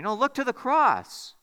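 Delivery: loud, emphatic pulpit appeal; impassioned preaching. Real, not acted. Preacher urging listeners to look to the cross, impassioned. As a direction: press each phrase home with rising force.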